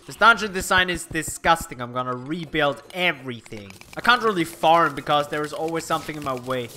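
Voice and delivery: normal talking voice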